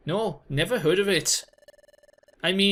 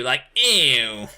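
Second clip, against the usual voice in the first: ordinary talk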